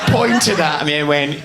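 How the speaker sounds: ordinary delivery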